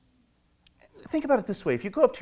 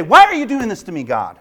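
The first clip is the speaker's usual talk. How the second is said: shouted, fast, higher accusation; mock angry outcry; 5 semitones higher, 8 dB louder than usual. Grumbler shaking fists at God, shouting. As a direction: fling the question up at God with a shaken fist. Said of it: "shake their fists and say".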